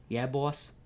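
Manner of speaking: lightly voiced mafia henchman; gangster voice. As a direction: answer like a mob goon.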